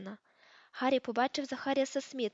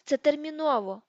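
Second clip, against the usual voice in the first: голосно й високо, зі злістю; наполягай розлючено, бо час спливає; люто; 4 semitones higher, 7 dB louder than usual. A narrator's reading. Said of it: "з люттю сказав він". (лютий вигук)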